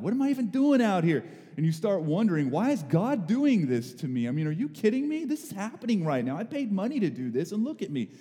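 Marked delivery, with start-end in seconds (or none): 0.0-1.2 acted exasperation, high and loud; frustrated outburst. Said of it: "I'm starting to get frustrated". 2.5-8.1 high-pitched indignant rant, acted out; mad and frustrated. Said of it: "and I'm mad".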